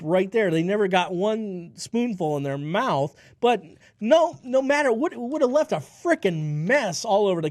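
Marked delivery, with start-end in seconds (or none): none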